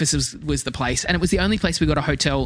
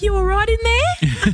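high, drawn-out call to a friend inside; calling through window; 4 dB louder, slower than usual. Retold or imitated. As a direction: check on her from outside, calling slowly. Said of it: "knocks on the window like".

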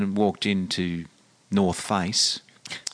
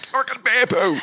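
much higher, louder, fed-up bear poo; exasperated outburst; 3 dB louder than usual. Retold or imitated. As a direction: blurt it out in frustration. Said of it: "And I went".

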